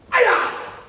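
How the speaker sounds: drawn-out high shouted hi-ya; loud kiai. Shown as a demonstration. Instructor finishing the palm strike with a kiai. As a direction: yell it with everything. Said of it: "finish with a loud key up".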